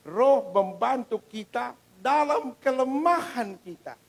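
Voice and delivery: very slow, loud, high opening of the verse; drawn-out loud proclamation; booming scripture. Acted. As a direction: boom it out from the pulpit. Reading scripture with force.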